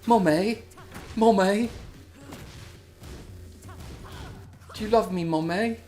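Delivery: put-on high, whiny little-kid voice calling for mommy; clingy childlike voice. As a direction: plead like a needy toddler. Voicing a clingy game character.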